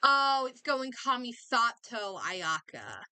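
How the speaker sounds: ordinary talk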